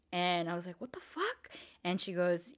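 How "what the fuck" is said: high, soft cry of disbelief; retold shock. Child told by sister that mom's in jail, shocked. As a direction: act out stunned disbelief, pitched way up.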